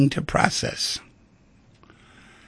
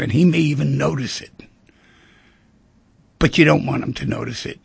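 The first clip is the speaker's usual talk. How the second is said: usual speaking voice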